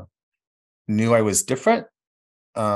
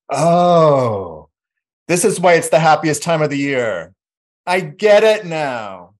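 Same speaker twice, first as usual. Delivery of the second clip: high, loud euphoric discovery; 7 semitones higher, 7 dB louder, slower than usual. Retold or imitated. First drink at Christmas, boy gushing high and loud. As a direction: gush with tipsy delight. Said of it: "And I was like".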